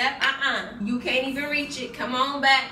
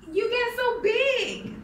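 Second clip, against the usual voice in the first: high, slow doting coo; 10 semitones higher, slower than usual. Real, not acted. Mom cooing at her baby boy, high and slow. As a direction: coo over him lovingly.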